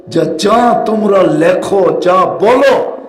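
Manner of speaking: normal talking voice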